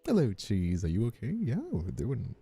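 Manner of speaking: ordinary talk, nothing marked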